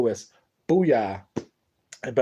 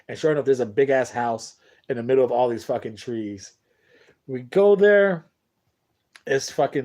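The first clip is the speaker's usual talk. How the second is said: normal talking voice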